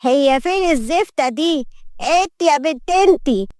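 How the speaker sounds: high, slow demanding matriarch; imperious grandmother. Acted. Grandmother calling for the new maid, imperious. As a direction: summon the maid with slow, high-pitched disdain.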